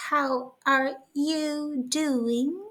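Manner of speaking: slow, drawn-out posh English, like royalty; a mild attempt at an upper-class English accent. Shown as a demonstration. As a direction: play a lightly done English royal greeting guests. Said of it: "as if I was, some kind of a, English royalty".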